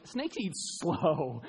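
very slow drawn-out words; deadpan slow drawl. Pretend. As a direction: drag it out like a slow snake. Joke aside on slow snakes.

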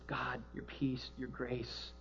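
soft, slow bedside prayer; hushed prayer beside a dying woman; quiet prayer. Acted. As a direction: pray gently over her. Praying at the bedside.

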